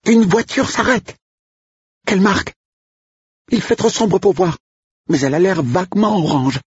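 very high, excited squeal of a voice; piercing high voice, breathless with excitement; shrill excited cook. Acted. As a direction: pitch the cook way up in a giddy squeal. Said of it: "Avec une voix suraiguë".

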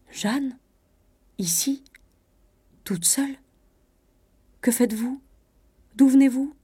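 halting, rising astonished questions; stupefied. A narrator's reading. Cousin astonished to find Jeanne here alone, stupefied. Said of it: "Il s'arrêta stupéfait".

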